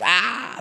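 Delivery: high, drawn-out mock scream; imitated scream. Imitates girls' fright as Pee Wee jumps.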